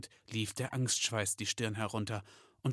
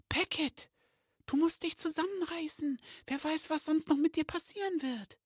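very high squeaky elf voice; agitated elf girl. Acted. Kistel urging her fellow elf to pull himself together, agitated, very high. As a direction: scold in an agitated, tiny high-pitched voice. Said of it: "sagte sie aufgeregt".

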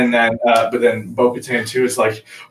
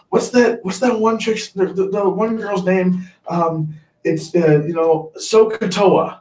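a light mock of his wife fumbling for a name, pitched higher; imitating wife; 8 semitones higher, slower than usual. Husband imitating his wife forgetting a name, lightly voiced. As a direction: play her groping for the name. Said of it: "She was like".

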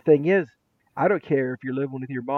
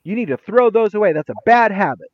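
louder, higher mock reprimand; acted scolding; 6 semitones higher, 5 dB louder than usual. Teacher to student about piled-up tissues, mock scolding. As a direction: lecture the student, starting high and loud.

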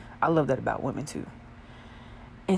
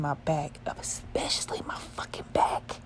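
whispering; 2 semitones lower, 5 dB quieter than usual. Real, not acted. Complaining about sore spots, whispered.

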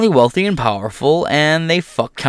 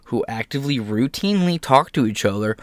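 ordinary delivery

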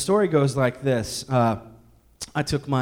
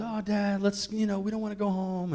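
put-on whiny kids; 5 semitones higher, 4 dB quieter than usual. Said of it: "and as always".

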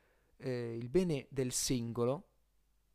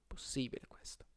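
whispered doubtful aside; sceptical whisper; 7 dB quieter than usual. Real, not acted. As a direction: breathe it out in doubt, unvoiced.

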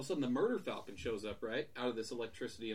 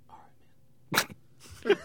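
unvoiced whisper; whispered reply; 9 dB louder, much slower than usual. [name]'s subdued reply.

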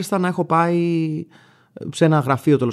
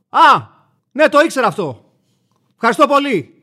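loud, high, still-bristling thanks; aggressive thank-you; 8 semitones higher, 6 dB louder than usual. Retold or imitated. Customer snaps thanks at the helpful bank clerk, loud and high. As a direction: thank him through clenched annoyance, loudly. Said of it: "τον ευχαριστείς χωρίς όμως να χαμηλώσεις την ένταση της επιθετικότητάς σου".

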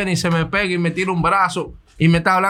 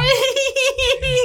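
squealing laugh, much higher than his voice; high-pitched burst of laughter. Real, not acted. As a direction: break into a high helpless laugh. Laughing at his own story.

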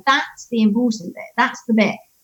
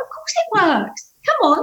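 put-on matter-of-fact child; 10 semitones higher than usual. Son voiced.

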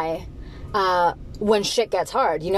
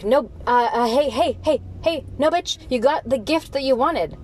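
higher, exasperated mock lecture; life scolding you; 5 semitones higher than usual. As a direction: scold like a fed-up parent, voice climbing. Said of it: "and life's like".

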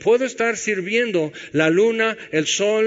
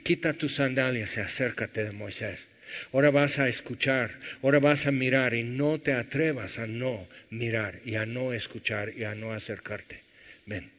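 God speaking; 6 semitones lower, 7 dB quieter than usual. Retold or imitated. Preacher voicing God telling Moses to come near, hushed and grave. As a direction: lower and much softer, a solemn command. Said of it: "Y por eso Dios dice".

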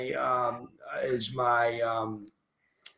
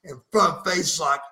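wrestling promo; 3 semitones higher than usual. Acted. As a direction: hype yourself up like a pro wrestler. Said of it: "Cut me a Front Face Lock podcast promo as Buff Bagwell".

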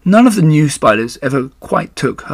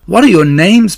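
higher and louder, bursting with happiness; trembling with joy; 7 semitones higher, 8 dB louder than usual. Acted. Overjoyed pig asking the new spiders, eager and loud. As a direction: ask eagerly, barely containing joy. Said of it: "trembling with joy".

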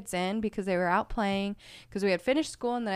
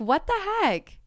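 indignant what the heck; 8 semitones higher than usual. Retold or imitated. Mom entering kids' freak-out.

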